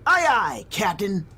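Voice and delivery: playful, cheeky aye aye captain; mock salute. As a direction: tease her with a jokey salute. Mock salute to bossy Lonnie.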